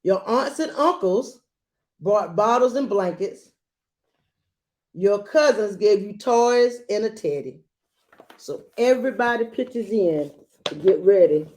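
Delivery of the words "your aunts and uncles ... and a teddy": put-on gentle read-aloud to a child; storybook reading voice; story narration. A narrator's reading. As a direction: read it like a bedtime picture book. Reads picture book aloud.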